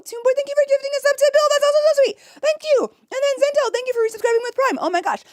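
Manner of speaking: high, loud, fast gushing thanks; excited thanks. Real, not acted. Streamer thanks gifters in a high, fast, excited gush. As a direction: rush out grateful squeals.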